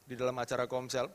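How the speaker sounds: ordinary delivery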